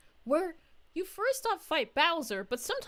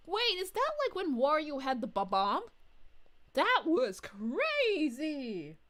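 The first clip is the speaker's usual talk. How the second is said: ordinary talk, nothing marked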